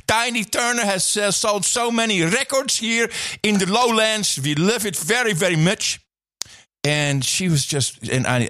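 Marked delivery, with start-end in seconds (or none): 0.1-6.0 high mock of a clumsy Dutch host; farmer guy's speech. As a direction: ham up the bumbling speech. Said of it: "And he starts off by".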